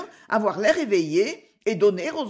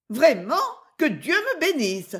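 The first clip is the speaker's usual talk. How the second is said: higher-pitched burst of astonishment; startled outcry, moderately voiced; sudden exclamation; 6 semitones higher than usual. A narrator's reading. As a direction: exclaim it as if suddenly taken aback. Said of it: "s'écria soudain la laveuse de vaisselle".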